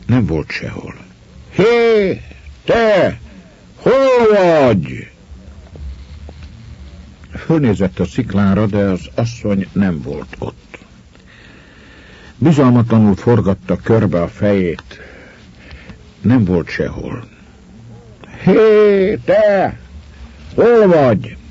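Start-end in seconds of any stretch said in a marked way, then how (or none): 1.5-5.1 high, drawn-out call. A narrator's reading. Calling to the vanished woman. 18.4-21.4 high, louder searching call. A narrator's reading. Calling to the vanished woman.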